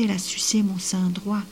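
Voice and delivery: usual speaking voice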